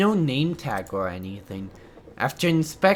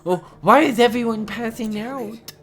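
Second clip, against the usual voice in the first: higher, alarmed outcry; acted panic; 6 semitones higher, 4 dB louder than usual. Passenger seeing another boy fallen, panicked. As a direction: cry out in mounting alarm. Character panicking at more collapses.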